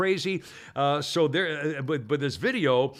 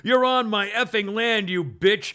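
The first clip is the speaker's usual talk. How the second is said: higher, louder retold screaming rant; mock screaming; 5 semitones higher, 6 dB louder than usual. Pot store owner screaming threats at the calm trooper, loud. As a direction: hammer him with insults. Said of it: "She's still screaming at him".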